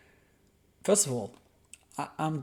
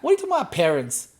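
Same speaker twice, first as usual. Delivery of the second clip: much higher and louder, mocking disbelief; exasperated shout; 4 semitones higher, 6 dB louder, much faster than usual. Real, not acted. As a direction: yell it at the screen. Mocking the PM's speech.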